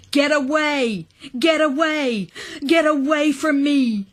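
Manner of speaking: high, strained, repeated yell; screaming. A narrator's reading. Nathan throwing blocks at me, screaming high. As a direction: drive them off with high cries. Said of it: "He kept screaming that".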